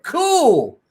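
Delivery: high, loud, drawn-out cool; excited cool. Retold or imitated. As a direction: sing out a big happy cool. Answering Nicholas in retold chat.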